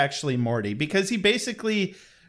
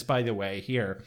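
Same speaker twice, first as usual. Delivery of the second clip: normal talking voice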